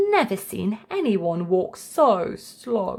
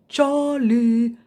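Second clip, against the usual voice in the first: grandpa calling; 2 semitones higher, 5 dB louder than usual. A narrator's reading.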